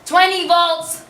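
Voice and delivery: loud, high announcement; called-out command. Acted. Staff giving electroshock to the patient, loud command. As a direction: call out the dose forcefully. Shock dose called out during therapy.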